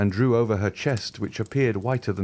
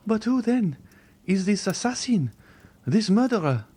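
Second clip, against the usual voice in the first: put-on high, pleading young woman's voice; a terrified girl refusing to believe; frightened young girl; 13 semitones higher than usual. A frightened young Valentine begging the Count to leave her.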